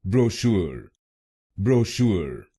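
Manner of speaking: ब्रोशूर said as bro-SHOOR with r sounded; ब्रोशूर with American r-colored ending; American pronunciation. Shown as a demonstration. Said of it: "American English में इसको बोलेंगे". (American उच्चारण में ब्रोशूर)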